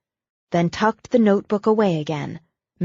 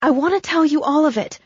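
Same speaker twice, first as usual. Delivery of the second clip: urgent rush; 9 semitones higher, 3 dB louder, much faster than usual. Acted. Abigail urgently insisting.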